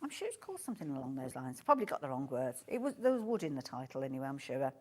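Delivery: usual speaking voice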